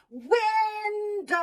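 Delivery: called out high and loud, drawn long; stretched high call; dog calling. Shown as a demonstration. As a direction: call the word out like calling a dog across a yard. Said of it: "So we do the dog calling".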